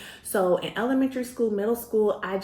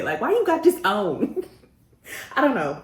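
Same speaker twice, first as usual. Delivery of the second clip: laughing; 6 semitones higher, 4 dB louder than usual. Real, not acted. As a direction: giggly question.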